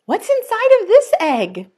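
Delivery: storyteller's curious question; curious wonder. Acted. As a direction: tease the mystery for little listeners. Wondering aloud to children.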